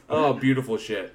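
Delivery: usual speaking voice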